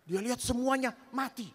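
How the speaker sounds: usual speaking voice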